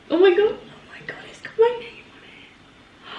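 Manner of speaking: high, breathy whisper of disbelief; breathless excited whisper. Real, not acted. Whispering to viewers as she unboxes her plaque, overwhelmed. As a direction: whisper it, barely able to believe it.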